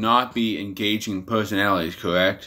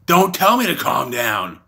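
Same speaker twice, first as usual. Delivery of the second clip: mild mock yell, pitched higher; higher-pitched imitated outburst; retold scream; 4 semitones higher than usual. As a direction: imitate someone screaming, at partial volume. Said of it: "screamed at the top of her lungs".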